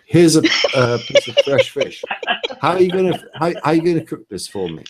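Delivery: Gordon Ramsay. Pretend. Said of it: "let me do the, um, Gordon Ramsay on you".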